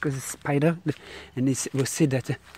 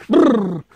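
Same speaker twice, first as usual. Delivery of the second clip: high, loud shuddering brrr; shivering; 7 semitones higher, 10 dB louder than usual. Real, not acted. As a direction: shudder with the creeps. Shivering at the creepy place.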